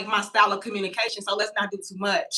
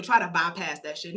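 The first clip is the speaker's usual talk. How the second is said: normal talking voice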